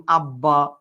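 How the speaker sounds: আব্বা with a firm, held double b; drawn-out, low আব্বা, both b's distinct; clearly sounded b. Shown as a demonstration. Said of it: "এটার উচ্চারণটা হচ্ছে একদম সুস্পষ্ট".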